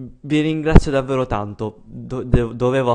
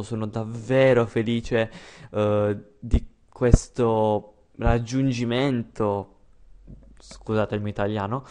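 ordinary delivery

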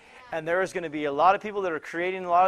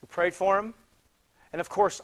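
usual speaking voice

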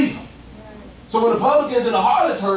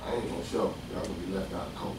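much lower and softer, a throwaway mumble; dismissive no-show; 12 semitones lower, 14 dB quieter, faster than usual. Retold or imitated. Preacher mimicking a volunteer who won't show, mock dismissive. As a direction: shrug it off like you never meant to come.

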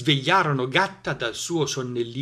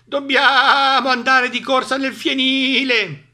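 slow, loud, high bleat; bleating sheep; 13 semitones higher, 9 dB louder, slower than usual. A narrator's reading. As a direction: stretch the words like bleating sheep. Sheep urge rushing. (pecore che belano spaventate)

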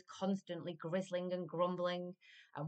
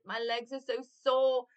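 ordinary delivery

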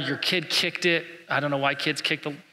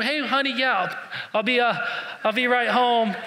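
fake phone call; 8 semitones higher than usual. Fake phone call to dodge salesman.

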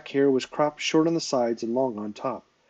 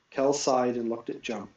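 usual speaking voice

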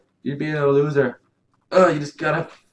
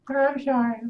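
put-on high, softer little-boy apology; lightly voiced kid saying sorry; sheepish kid; 11 semitones higher, 4 dB quieter than usual. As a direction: back down like a caught child. Son apologizes again.

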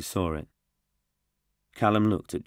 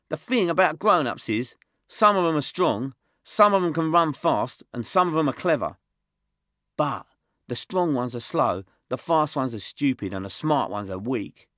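put-on little tough kid; 8 semitones higher, 5 dB louder than usual. Josh boasting to the group, high and loud. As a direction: brag like a scrappy kid. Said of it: "said Josh".

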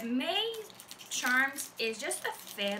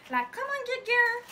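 excited outburst; 11 semitones higher, 7 dB louder than usual. Real, not acted. Excited exclamation at box.

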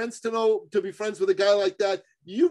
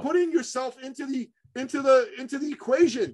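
much higher, forceful, near-shouting tirade; fired-up rant; 6 semitones higher than usual. Real, not acted. Coach tells listeners to stop pushing their program, heated near-shout. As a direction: hammer the point home like a fed-up coach. Rant against self-focused selling.

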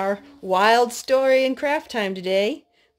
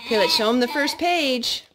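ordinary talk, nothing marked

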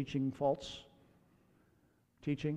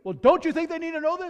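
indignant; 11 semitones higher, 4 dB louder than usual. Retold or imitated. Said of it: "He goes".

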